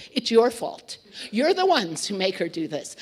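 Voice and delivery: a loud, half-playful scolding; mock angry blame. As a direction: imitate the grumpy boss accusing the staff, loud. Erich blaming the staff.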